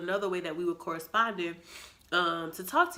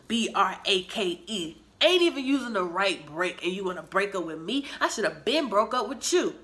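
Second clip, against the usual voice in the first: petty anger; 6 dB louder than usual. Retold or imitated. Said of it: "when I am mad, ooh, I am petty".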